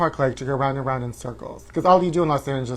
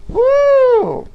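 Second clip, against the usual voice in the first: loud, very high whoop; celebratory woo; 7 dB louder than usual. Real, not acted. Host cheering for pride, loud high woo. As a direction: hold a long, high "woo".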